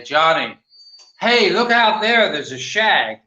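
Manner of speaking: a hint of a mother calling her boy, loud on "hey"; mildly voiced mother pointing out the window. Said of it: "my mother used to say".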